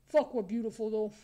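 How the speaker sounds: usual speaking voice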